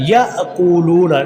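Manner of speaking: याअकूलूना recited slowly, loo held long; lengthened lam. Shown as a demonstration. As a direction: catch after ya in याअकूलूना, then stretch loo. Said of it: "अगले लाम को लंबा करना". (याअकूलूना में 'लू' लंबा खींचें)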